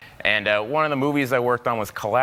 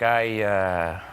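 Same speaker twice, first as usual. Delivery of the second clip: a lightly lower, laughing Cruise compliment; lower Tom Cruise; 6 semitones lower, 4 dB quieter, slower than usual. Retold or imitated. Tom Cruise leaning in to praise a young actor, a touch lower. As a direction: say it like an intense star meeting a fan.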